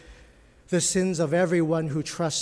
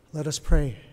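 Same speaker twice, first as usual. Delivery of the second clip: ordinary delivery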